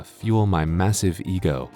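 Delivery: ordinary delivery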